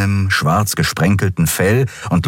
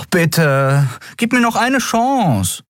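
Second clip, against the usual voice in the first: much higher, slow desperate plea; begging Miko; 11 semitones higher, slower than usual. A narrator's reading. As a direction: beg for one last chance. Miko begs for another chance.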